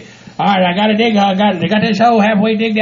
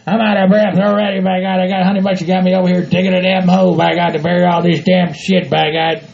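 usual speaking voice

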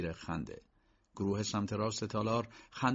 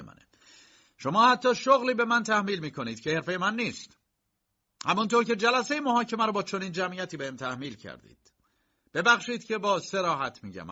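raised, higher, forceful indignant protest; sharp and firm; 7 semitones higher, 5 dB louder than usual. A narrator's reading. Accused K rebukes the magistrate before the crowd, sharp and firm. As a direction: object loudly and firmly, building in pitch and force. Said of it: "کی با لحنی تند و محکم گفت".

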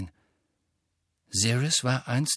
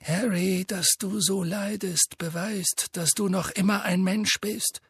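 put-on gentle old headmaster; 8 semitones higher than usual. A narrator's reading. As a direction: comfort the boy, unhurried. Dumbledore consoles Harry.

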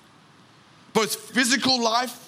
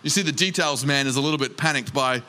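normal talking voice

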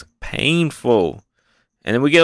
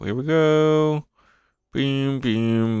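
higher, slow drawn-out "Here we go"; playful higher "Here we go"; sing-song announcer; 3 semitones higher, much slower than usual. Shown as a demonstration. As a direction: stretch "go" like a hype call.

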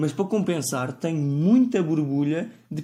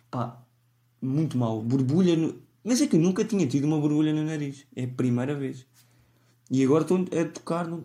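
ordinary talk, nothing marked

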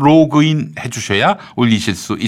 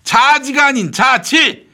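exasperated shout; 11 semitones higher than usual. Acted. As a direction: drag out 질 to correct her. Correcting the misheard word loudly. (답답해서 높게 외치며 바로잡음)

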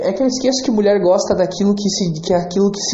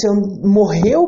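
ordinary delivery

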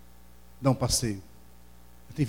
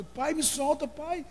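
put-on very high desperate child's wail; screaming little boy; much slower than usual. Boy held down by the doctor, high wailing child voice. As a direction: beg to be let go, desperate. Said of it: "quanto mais ele gritava".

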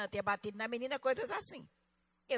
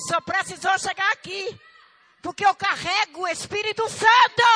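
high, very loud proclamation building to a shout; shouted preaching climax; 8 semitones higher, 17 dB louder than usual. Real, not acted. Preacher tells church she carries the Spirit, shouted climax.

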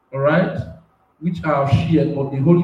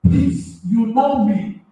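higher, fervent preaching as the spirit takes him; impassioned prophetic preaching; 8 semitones higher than usual. Real, not acted. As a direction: preach it like God is pressing on you. Prophet overcome, preaching at height.

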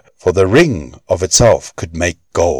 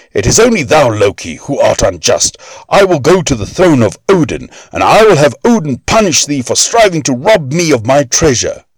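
higher, pressed voice full of defiance; raging dwarf; 7 semitones higher than usual. A narrator's reading. Dwarf defying Loki, high and furious. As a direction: threaten him with Odin's judgment.

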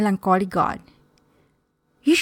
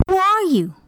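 amazed; 9 semitones higher than usual. Acted. Richard jumps at seeing God in his room, amazed. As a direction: ask in amazement, pitch lifted high. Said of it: "Richard jumps in amazement".

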